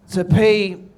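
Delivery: put-on slow, lightly theatrical drunk Hamlet; mock-recite like a drunk actor; drunk Shakespeare. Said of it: "It's like shit-faced Shakespeare".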